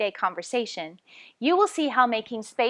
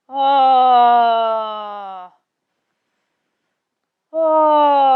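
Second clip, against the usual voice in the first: loud ah, jaw and palate closed; tight, muffled ah without space; trapped muffled ah; 11 dB louder than usual. Shown as a demonstration. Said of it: "don't drop your jaw or raise your soft palate".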